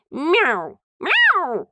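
high rising meows; cat meows. Acted. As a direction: yowl twice like a cat. Cat yowling at thrown stone.

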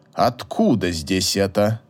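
put-on slow, very low old man's disdain; cold, drawn-out distaste in a low voice; disdainful. A narrator's reading. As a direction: ask as if inspecting a cockroach. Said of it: "таким тоном, будто рассматривал чрезвычайно редкого таракана".

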